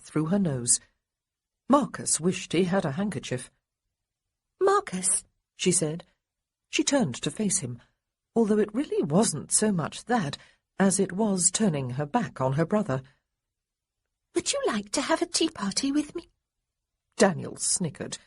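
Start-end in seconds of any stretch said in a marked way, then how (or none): 4.6-5.2 put-on high girlish call of his name; a small girl calling him sternly; little girl voice. A narrator's reading. Little sister turning to Marcus, high girlish voice. 14.4-16.3 put-on high, earnest girlish invitation; a small girl asking very properly; little girl voice. A narrator's reading. Little girl inviting Marcus to tea, high and earnest.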